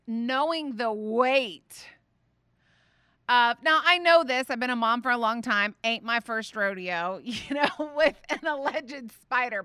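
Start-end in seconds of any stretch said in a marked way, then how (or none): none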